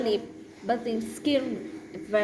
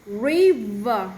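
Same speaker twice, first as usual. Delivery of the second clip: strong V; 5 dB louder than usual. Shown as a demonstration.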